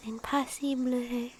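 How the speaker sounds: soft unvoiced whisper, playful threat; hushed mock-stern whisper; whispered mock scolding. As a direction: whisper a teasing bedtime order.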